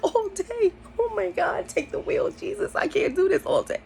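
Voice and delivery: squeaky high panic, falling to a near whisper; a frantic prayer under her breath; panicked inner voice. Retold or imitated. Hearing the high lasts all day, panicked inner squeak. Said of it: "Inside, I was like".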